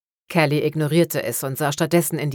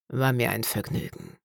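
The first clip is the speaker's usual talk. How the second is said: put-on softer, low old-man rumble; grumbling old man; 3 semitones lower, 7 dB quieter than usual. A narrator's reading. Dead old man says goodbye to the necromancer, low grumble. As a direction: growl it out contentedly as the old man. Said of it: "brummte der alte Mann".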